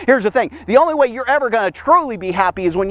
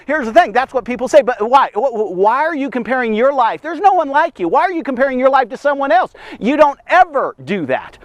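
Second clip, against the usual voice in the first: normal talking voice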